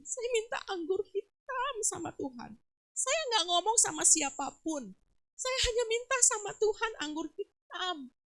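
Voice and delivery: retold tearful outpouring, pitched high; tearful testimony. Preacher voices patient whose prayer was answered, imitated tearful testimony. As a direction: plead it high, imitating someone moved to tears. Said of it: "nangis loh dia".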